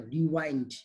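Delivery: रिवाइंड, ai vowel; rewind, long ai. Shown as a demonstration. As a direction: hum out रिवाइंड as in the song. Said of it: "रिवाइंड करो".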